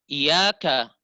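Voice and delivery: "Iyyaka" with no lift on the yy; straight, without nabr. Shown as a demonstration. Teacher shows faulty reading, straight without lift. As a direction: say "Iyyaka" loud and straight through. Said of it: "baca dengan bacaan yang lurus".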